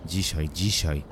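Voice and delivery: mildly hissed, irritated echo; understated hiss of grudging assent. A narrator's reading. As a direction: repeat it with a touch of annoyance. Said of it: "syknąłem".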